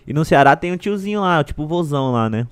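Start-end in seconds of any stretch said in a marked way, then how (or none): none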